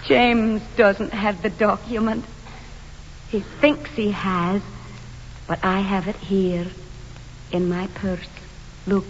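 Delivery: low, soft, measured reveal; quiet confession. Acted. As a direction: confide it quietly, with pauses between phrases. Confides a secret about document.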